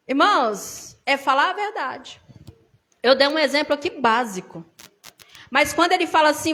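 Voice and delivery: normal talking voice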